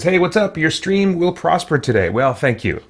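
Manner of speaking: ordinary delivery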